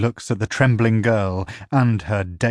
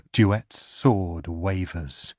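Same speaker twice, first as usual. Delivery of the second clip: ordinary delivery, nothing marked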